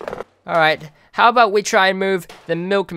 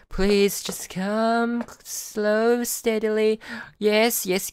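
soft, higher, slow coaxing; 6 semitones higher, 6 dB quieter, much slower than usual. Real, not acted. Gamer pleads with character to move slowly, soft and slow. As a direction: ease it along gently, slowly.

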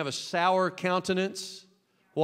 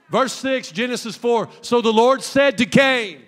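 full-force preaching; 7 semitones higher, 11 dB louder than usual. Acted. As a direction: stretch the question out big.

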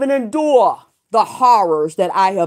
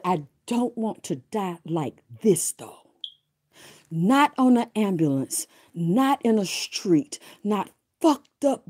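hushed prayer; 4 semitones lower, 8 dB quieter than usual. Retold or imitated. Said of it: "I said".

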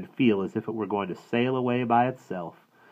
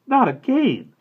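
much higher, louder groan of dismay; exasperated exclaim; 7 dB louder, slower than usual. A narrator's reading. Mother learns the cow escaped again, high and exasperated. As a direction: wail it in exasperation. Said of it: "Mama exclaimed".

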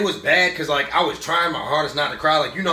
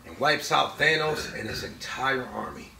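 softer, lower, voice caught with tears; choked up; 10 semitones lower, 11 dB quieter than usual. Real, not acted. Vlogger retelling Iron Man's sacrifice, softer and choked up. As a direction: hold back real tears. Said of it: "I ain't gonna try to cry".

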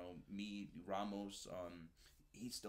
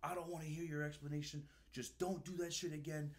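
angry scolding; 8 semitones higher than usual. Retold or imitated. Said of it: "He's like".

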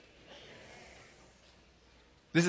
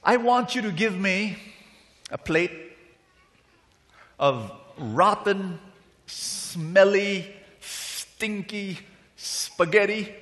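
higher-pitched customer relishing a disgusting order; demanding restaurant customer; 4 semitones higher, slower than usual. Acted. Man at a restaurant table orders from the waiter, hammed-up demanding customer. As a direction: draw out the revolting details slowly. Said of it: "And the man says".

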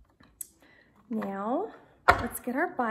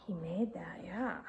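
whispered aside; 9 semitones lower, 10 dB quieter than usual. Real, not acted. Quiet aside.

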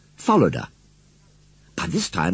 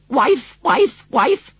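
much higher, louder bird scolding; little cock robin scolding his wife; 6 dB louder, much slower than usual. A narrator's reading. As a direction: chirp it out like a cross robin. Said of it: "he scolded".

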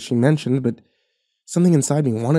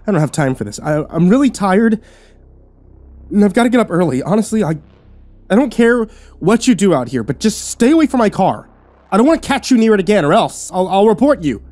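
louder and higher, exasperated; telling the woman to stay off the car; fed-up rant; 8 semitones higher, 4 dB louder than usual. A narrator's reading. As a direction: warn her off, tired and angry.

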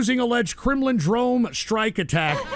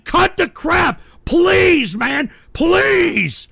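high, drawn-out shouted plea; worked-up, high-pitched begging; exasperated pleading yell; 7 semitones higher, much slower than usual. Real, not acted. Host begs trolls to stop.

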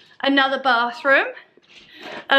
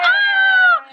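high surprised squeal; 5 dB louder than usual. Real, not acted. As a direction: squeal in delight. Friend appears.

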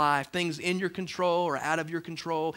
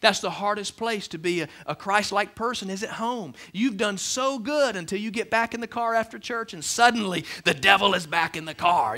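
normal talking voice

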